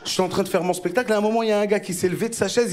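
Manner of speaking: usual speaking voice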